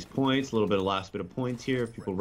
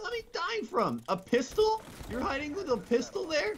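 squeaky, very high questioning; high-pitched incredulity. Real, not acted. Gamer baffled by a pistol in hiding, incredulous.

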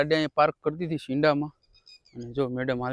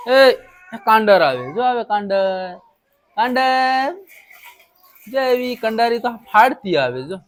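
calling out; 7 semitones higher, 8 dB louder than usual. Real, not acted. Calling out to someone far.

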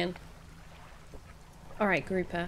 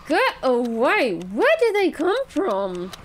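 alarmed shout; 3 semitones higher, 6 dB louder than usual. Real, not acted. Player's fish are being stolen, alarmed shout. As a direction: shoo them off in a panic. Shooing thieves in game.

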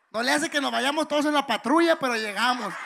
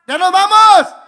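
shouted announcement, pitched up; loud shout; 7 semitones higher, 17 dB louder, slower than usual. Pretend. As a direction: belt it out to the crowd. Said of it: "que escuche bien machín el no".

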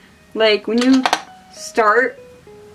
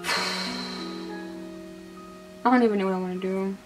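hungover; 9 dB quieter, slower than usual. Real, not acted. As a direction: sigh it out, too tired to care. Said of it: "feeling like shit".

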